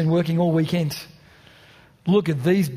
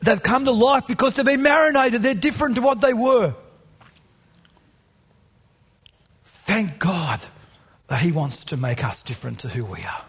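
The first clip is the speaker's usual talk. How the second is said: impassioned preaching; 5 semitones higher than usual. Acted. Rising passionate preaching climax.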